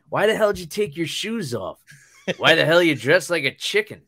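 high, indignant movie-quote voice; mock outraged crooks' bickering; imitating Marv and Harry. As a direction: build to a comic shout. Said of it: "and they're like".

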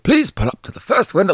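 high, put-on Old English voice; Old English accent. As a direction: imitate a theatrical Old English drive-thru server. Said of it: "just doing the whole thing like that".

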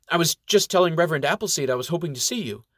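submissive. Acted. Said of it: "suddenly turning weak and submissive".

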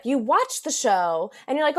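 usual speaking voice

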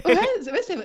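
laughing high, caught out by a joke; giggly, high and amused. Real, not acted.

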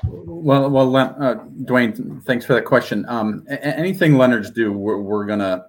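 real nervy stumble, restarting words; stuttering. Real, not acted. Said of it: "I'm stuttering a little bit".

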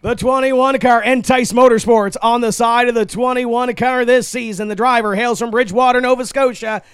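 booming announcer, names stretched and shouted; driver introductions. Acted. Track announcer introduces the starting field.

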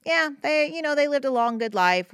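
high, put-on voice parroting what people say; mock consoling platitude. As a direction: imitate the stock condolence, lifted up high. Quoting what people typically say.

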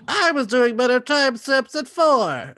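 high, loud, slow mocking mother voice; mean stage mother. Mother belittling ten-year-old Ivy, high loud mock scorn. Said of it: "her mother's like".

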